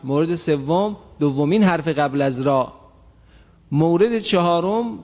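ordinary delivery

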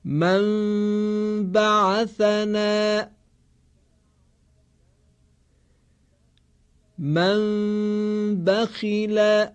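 slow, drawn-out recitation tone; slow Quran recitation. Shown as a demonstration. Teacher reciting practice examples for students to repeat. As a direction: chant each example slowly, holding the nasal sounds.

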